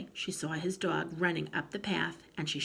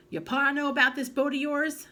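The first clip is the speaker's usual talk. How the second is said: higher, louder probing question; suspicious grandma; 9 semitones higher, 6 dB louder than usual. A narrator's reading. Grandma probing whether pa knows, louder and higher. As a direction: press him hard.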